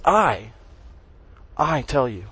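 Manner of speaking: "I" set apart and repeated, then a pause; weighty "I", with "tell you" falling away; stress on I. Shown as a demonstration. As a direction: land firmly on "I" and let it hang. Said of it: "it's emphasized".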